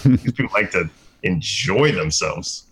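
ordinary delivery

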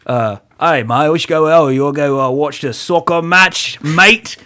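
hammed-up fake English accent; mock British accent. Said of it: "we go like".

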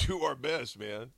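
ordinary talk, nothing marked

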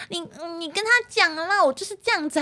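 high, soft coy protest; cutesy whiny girl. Retold or imitated. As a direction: whine it sweetly. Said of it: "那女生就很奶在撒娇".

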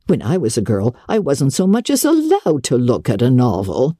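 put-on a no-nonsense older woman cutting in; stern Marilla. Marilla scolds Anne, lower voice.

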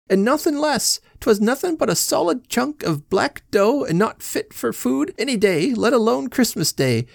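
very high wailing plaint; crying. Acted. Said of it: "Missus Casey began to cry".

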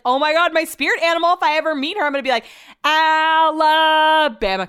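high, loud fangirl squeal; excited gush. Retold or imitated. Gushing to herself about meeting [name], high and loud. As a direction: gush like a starstruck fan. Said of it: "I was like".

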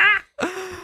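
giggling burst; high laugh. Real, not acted. Laughing at name mixup.

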